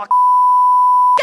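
piercing high scream; shrill scream. Retold or imitated. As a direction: let out a furious shriek. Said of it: "狂大骂脏话".

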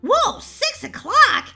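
high, loud, drawn-out surprise; startled disbelief. Acted. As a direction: exclaim slowly in shock at the time. Startled at how late it is.

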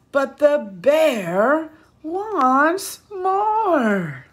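slow, loud and drawn out; big refrain. Shown as a demonstration. Reading the refrain to children, slow and loud. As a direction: stretch the refrain out for the kids.

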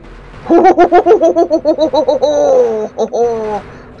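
big burst of laughter; loud laugh. Real, not acted. Big laugh after run.

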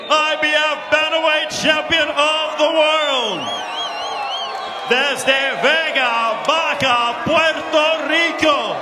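bilingual, drawn-out champion introduction; milk the champion's name slowly; lucha libre announcer. Acted. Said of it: "lucha libre announcer".

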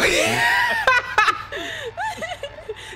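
high shouted laughter; laughing shout. Real, not acted. Friends' banter makes her shout with laughter. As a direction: whoop with laughter.

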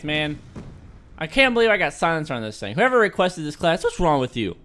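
ordinary talk

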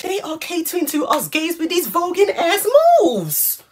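high-pitched gushing exclamation; excited high-voiced celebration; thrilled. Real, not acted. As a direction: gush it out high, rising to a squeal. Thrilled by voguing in the video.

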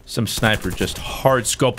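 normal talking voice

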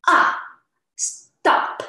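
"Ah" and "stop" dropping sharply; falling tone with anger. Shown as a demonstration. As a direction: throw "stop" down like an angry order. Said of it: "from the high pitch and quickly with anger".

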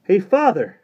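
high, loud call after someone; excited shout to get attention; exclaiming boy. A narrator's reading. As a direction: call out urgently in a boyish pitch. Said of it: "exclaimed Johnny".

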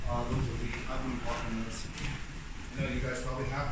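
hushed and wary; nervous night vlog whisper. Real, not acted. Hushed talk in dark building.

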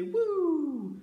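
high cheerful whoop; excited woo. Shown as a demonstration. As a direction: toss it up with a happy woo. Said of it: "or you can just say".

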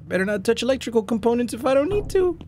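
normal talking voice

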